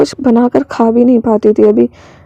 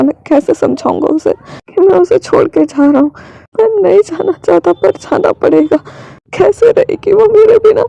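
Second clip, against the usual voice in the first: tearful lament, climbing much higher; acted crying; 5 semitones higher than usual. Wing alone, dreading leaving Shaozia behind, tearful. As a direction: build into a high, distressed plea. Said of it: "वो पूरी तरह रो रहा था".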